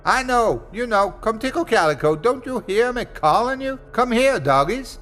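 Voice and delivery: very high, desperate call; Smitty calling again, desperate for his dogs. Acted. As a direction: plead for the dogs to come. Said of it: "so he called again".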